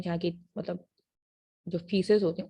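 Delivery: ordinary talk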